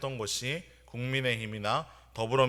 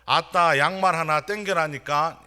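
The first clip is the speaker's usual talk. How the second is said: raised, high-pitched yell with 전라도 dialect; louder retold shout; 5 semitones higher, 8 dB louder than usual. Senior repeats the order to a junior who misheard, louder mock shout. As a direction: imitate an exasperated senior soldier, louder and higher. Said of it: "고참은 더 큰 소리로".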